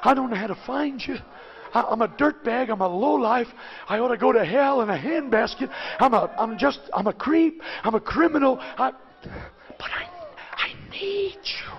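a man muttering his shame in the pew; muttered under breath. Retold or imitated. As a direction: confess it quietly under your breath. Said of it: "under my breath I'd be saying".

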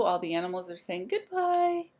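ordinary delivery, nothing marked

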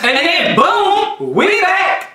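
high, drawn-out boom, laughing; hyped intro call. Acted. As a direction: announce the comeback with big energy. Retake of the video intro.

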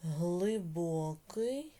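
each word drawn out slowly for writing; slow dictation. Shown as a demonstration. Teacher dictating to pupils, very slowly. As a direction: spell it out slowly so the class can write it down. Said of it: "teacher dictates word by word".

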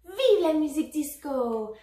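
high, loud hurrah for disco; shouted cheer. A narrator's reading. Teddy to the dancing toys, shouting happily. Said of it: "cria Teddy l'ourson".

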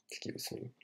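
unvoiced whisper. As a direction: whisper it like a secret. Whispered aside.

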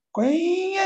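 high, long drawn-out squeal; imitated squealing noise. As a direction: stretch it into a high swooping cry. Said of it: "ಅಂತ ಅವನ ಶಬ್ದ ಆಗ್ತಿತ್ತಂತೆ". (ಎತ್ತರದ ಸ್ವರದಲ್ಲಿ ಎಳೆದ ಶಬ್ದಾನುಕರಣೆ)

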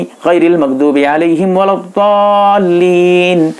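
slow, drawn-out Quranic chant in the imam's manner; imam's recitation. Retold or imitated. Teacher imitating the imam ending Fatiha before amin, slow chant. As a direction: intone it slowly, as the imam leads. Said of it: "उस वक्त आमीन कहेंगे, यानी".

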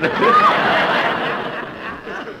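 high unvoiced burst of laughter; laughing. Real, not acted. Jack laughing at his own polo mallet joke, laughing. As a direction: break up laughing.

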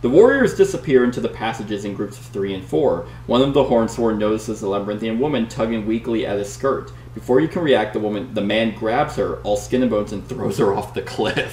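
dramatic read-aloud narration; story narration. Acted. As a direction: read it like an adventure gamebook. Reads the gamebook story aloud.

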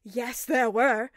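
slow, loud insistence; shot back. A narrator's reading. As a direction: argue back. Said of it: "Peter shot back".

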